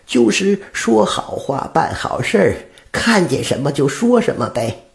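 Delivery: put-on high old woman explaining; patient old woman, high-pitched. Old woman explains doing good. (老太太耐心地解释，声音偏高)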